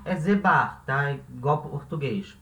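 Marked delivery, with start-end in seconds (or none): none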